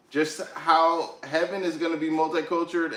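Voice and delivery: ordinary delivery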